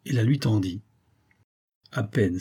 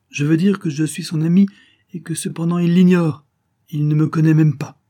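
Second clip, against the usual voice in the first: high, loud, emphatic explanation; insistent adventurer; 7 semitones higher, 8 dB louder than usual. A narrator's reading. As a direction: press the point with force. Rocambole insists he is Paul's friend. (explication appuyée, voix haute)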